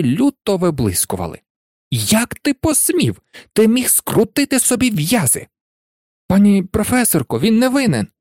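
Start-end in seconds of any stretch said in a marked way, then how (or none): 1.9-5.5 high, outraged teacher; agitated scolding. A narrator's reading. Professor scolding Harry for risking his neck, agitated scolding. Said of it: "Розхвилювавшись, професорка МакГонагал на силу могла говорити". 6.3-8.1 high, urgent protest; pleading pupil. A narrator's reading. Pupil defending Harry to the professor, pleading.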